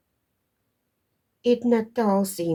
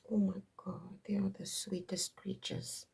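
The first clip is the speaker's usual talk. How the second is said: whispered and much softer; fond whisper; 12 dB quieter than usual. Real, not acted. Mother gushing about her sweet daughter, whispering. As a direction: breathe it like a tender secret.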